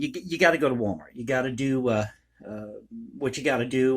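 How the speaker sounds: ordinary talk, nothing marked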